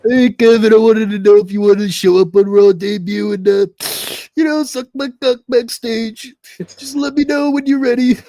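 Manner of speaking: loud, high-pitched wrestler impression; Triple H. Shown as a demonstration. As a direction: put on a loud, strained wrestling-boss voice. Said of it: "I do a really decent Triple H voice".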